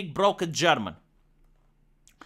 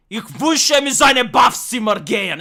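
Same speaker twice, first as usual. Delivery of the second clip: loud, barked fake German; mock German shout; 4 semitones higher, 7 dB louder than usual. Streamer mocks German as harsh, shouted fake German. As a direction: bark it like a German declaring war. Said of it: "and then you hear a German".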